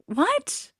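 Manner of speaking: high-pitched incredulous squeak; mock disbelief. Viewer reacting to the happy romantic ending, high mock disbelief.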